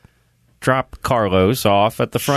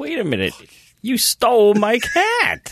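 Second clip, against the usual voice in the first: incredulous Schmanthony; 12 semitones higher than usual. Retold or imitated. Schmanthony voiced in disbelief.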